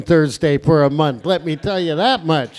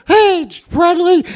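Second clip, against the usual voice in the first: higher, slow, louder stoned rooster; 9 semitones higher, 5 dB louder, slower than usual. Retold or imitated. Comic voicing the rooster greeting the Friendly Giant, high and slow. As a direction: call out like a stoned puppet rooster. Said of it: "The rooster in the bag".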